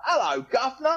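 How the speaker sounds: high-pitched imitation British accent; hammed-up British accent, as Americans put it on; mock British accent. Guest imitating Americans greeting him, mock British accent. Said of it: "Whenever they're doing a British accent".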